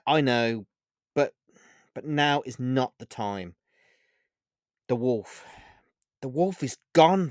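sad, exasperated plea; frustrated sadness. Acted. Olivia vents her loss to Vega, sad and frustrated. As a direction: vent your grief at him. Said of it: "sadness and frustration".

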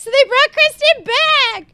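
high, loud, drawn-out squeal; real elation. Real, not acted. Fan tells co-host favourite doll returned, elated squeal. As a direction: stretch it out, overjoyed.

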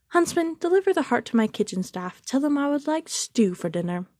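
high, imperious queen's command; lightly voiced wicked queen giving orders. A narrator's reading. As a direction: order it with cold pleasure. Queen orders heart made into stew.

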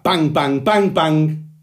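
high, loud pounding bangs. A narrator's reading. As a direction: shout every bang like a crashing hoof. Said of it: "even louder".

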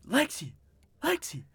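calling out. Retold or imitated. Calling for his friend in her dark room, high. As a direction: call the name up high, playful. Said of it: "asked her".

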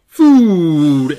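loud, drawn-out cry of joy; excited cry. A narrator's reading. Eddie answers the offer of a bite to eat, crying out loudly. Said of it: "Eddie cried".

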